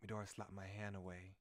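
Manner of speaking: ordinary talk, nothing marked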